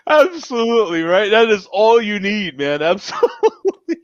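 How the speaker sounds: high-pitched through real laughter; laughing. Real, not acted. Host agreeing with his co-hosts after the impression, laughing. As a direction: agree while cracking up.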